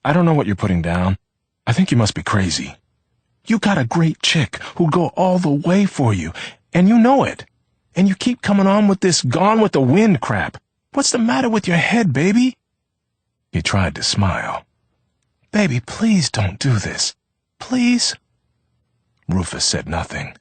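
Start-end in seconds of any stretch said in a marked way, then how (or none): 0.1-12.6 straining to be calm. A narrator's reading. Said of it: "trying to be calm". 15.5-18.1 rising high begging please; desperate plea. A narrator's reading. Leona pleading.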